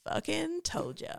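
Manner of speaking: slow, drawn-out mock LA drawl; vocal fry. Shown as a demonstration. Reading the note on the story, vocal fry. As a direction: stretch it out with an LA creak. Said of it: "this is the last vocal fry".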